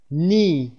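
"نی" for knee, starting straight on n; silent K. Shown as a demonstration. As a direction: say "نی" with a long ee and no k. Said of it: "کی در اینجا تلفظ نمیشه".

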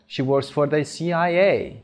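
slow sentence, final A of C I A stressed; stress on last letter. Shown as a demonstration. Teacher repeating the example for learners, last letter A stressed. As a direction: land on the A ending C I A.

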